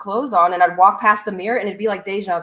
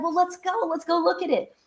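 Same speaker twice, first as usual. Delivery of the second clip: eager invite; 10 semitones higher than usual. Retold or imitated. Friend eagerly invites her.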